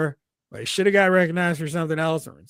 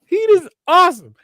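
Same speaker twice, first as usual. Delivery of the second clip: mock excited voice; 13 semitones higher, 8 dB louder than usual. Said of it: "And I was like".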